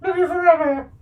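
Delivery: high, loud squeaky dog voice; voicing the dog. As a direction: speak for the excited dog. Voicing the dog's thoughts.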